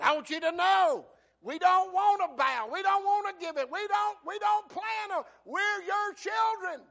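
high, shouted prayer; impassioned plea. Acted. King begs God for his children, impassioned shouted plea. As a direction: cry out to God at full preaching force.

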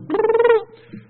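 squeaky high-pitched gibberish; high angry babble. Retold or imitated. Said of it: "one goes".

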